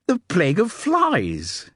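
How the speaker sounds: high, loud, triumphant cry of the answer; proud eureka shout; triumphant announcement. Acted. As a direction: announce it like a winning guess. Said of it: "he announced triumphantly".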